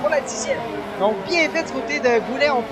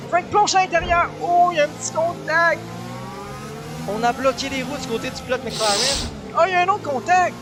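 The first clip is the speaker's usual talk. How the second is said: quick, raised contact call; motorsport commentator; 3 semitones higher than usual. Real, not acted. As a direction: snap to the collision. Contact called live. (commentateur vif sur le contact)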